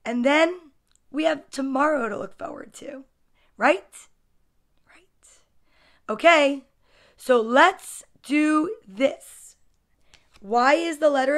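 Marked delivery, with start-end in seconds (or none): 4.9-5.3 very faint higher whisper. Real, not acted. Storyteller answers own question, hushed whisper. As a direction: echo it in a tiny whisper.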